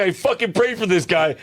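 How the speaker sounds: high-pitched, comic indignation; hammed-up incredulous complaint; mock pissed-off pastor. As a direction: play the outraged pastor for laughs. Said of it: "This pastor must have been pissed".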